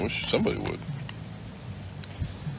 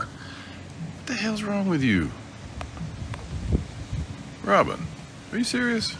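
much higher, slow mock-outraged; mock scolding; 13 semitones higher, 4 dB quieter, slower than usual. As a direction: tease the dog in disbelief. Scolding the dog playfully.

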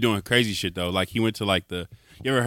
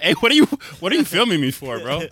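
louder and higher, mock-indignant; mock confrontation; 5 semitones higher, 5 dB louder than usual. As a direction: call him out like you caught him. Acting out prankster confronting customers.

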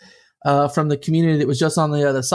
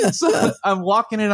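ordinary delivery

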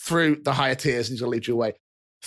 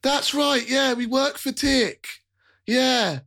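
high, apologetic chubby fellow; jolly rotund man; 11 semitones higher, slower than usual. Acted. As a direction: soothe the startled kid cheerfully. GM NPC voice.

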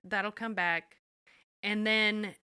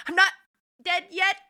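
put-on old woman insisting; 11 semitones higher, 4 dB louder than usual. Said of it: "she was not".

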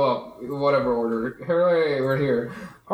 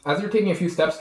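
ordinary talk

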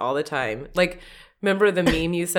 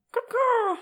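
very high, crow-like caw; failed hen squawk. Pretend. Pretending to be a hen laying an egg, failed squawk. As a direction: squawk like a badly imitated hen. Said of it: "that wasn't a hen".

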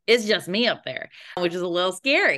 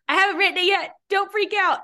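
1 high nervous reassurance; 6 dB louder than usual. Retold or imitated. Reassuring producer while panicking.

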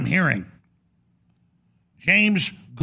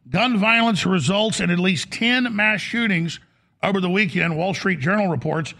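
ordinary delivery

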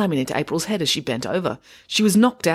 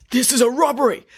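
raspy robber's yell, pitched up; 8 semitones higher, 3 dB louder than usual. A narrator's reading. Robber bursting into the bank, yelling. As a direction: announce a hold-up roughly. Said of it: "yelled the mud-covered person in a deep, raspy voice".

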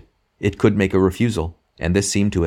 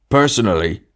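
PERSONALLY punched higher and louder; emphatic; 4 semitones higher, 5 dB louder than usual. A narrator's reading. Big ghost giving his opinion, "Personally" said emphatically. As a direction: lean hard on the word "Personally". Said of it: "said the big ghost with an emphasis".

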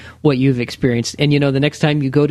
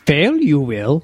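put-on wise old sage; slower than usual. Yoda inverted syntax.

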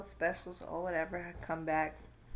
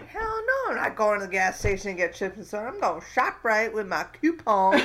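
comic, forceful retort; indignant refusal; 2 semitones higher, 10 dB louder than usual. Retold or imitated. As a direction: refuse loudly, proud of the better plan.

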